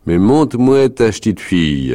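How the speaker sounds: loud, high rustic demand; insistent wolf leader. Acted. Wolf leader asks parents for their little girl, insistent. Meneur presses to see the girl.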